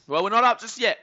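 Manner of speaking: loud, high protest; emphatic correction. Real, not acted. Correcting chatter, then repeats quieter.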